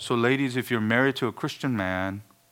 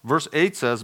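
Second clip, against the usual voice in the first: ordinary delivery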